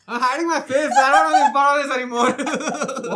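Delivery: embarrassed laughing while talking, high. Real, not acted. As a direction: laugh through the words, covering your face.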